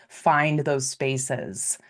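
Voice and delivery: usual speaking voice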